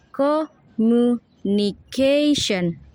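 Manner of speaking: "communication" slowly separated, CA longest; syllable by syllable. Shown as a demonstration. Student showing unstressed syllables, "communication" slow and split. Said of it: "unstressed syllables are co".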